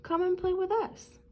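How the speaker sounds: high, calling little fish voice; a hint of friendly fish. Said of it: "they would call".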